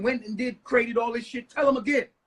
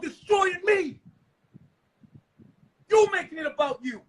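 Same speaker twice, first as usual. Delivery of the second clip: angry rant; 4 semitones higher, 3 dB louder than usual. Real, not acted. Ranting at wife, yelling.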